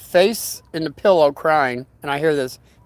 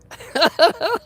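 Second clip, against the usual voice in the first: high giggle; 14 semitones higher than usual. Real, not acted. Laughs at his own joke.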